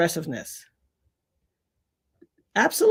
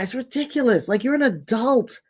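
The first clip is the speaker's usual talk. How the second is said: normal talking voice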